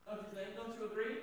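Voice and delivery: ordinary talk, nothing marked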